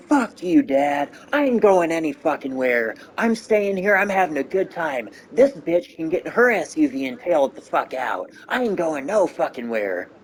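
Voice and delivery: high, loud, belligerent yell back at Dad; defiant shout. Retold or imitated. Uncle yells back at his father, refusing to leave, loud defiant shout. Uncle defies grandpa in retold fight.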